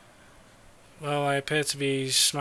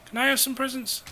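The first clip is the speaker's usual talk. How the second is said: put-on much higher, pleading kid voice; mock childish plea; 10 semitones higher than usual. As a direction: beg like a greedy little kid. Teasing game pedestrian.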